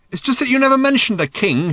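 high, loud, flustered protest; consternated shout. A narrator's reading. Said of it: "shouted Arthur back in some consternation".

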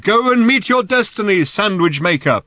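high, loud rousing cry; exhorting yell, falling at the end; shouting. A narrator's reading. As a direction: call it out grandly over distance. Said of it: "shouted Thrashbog".

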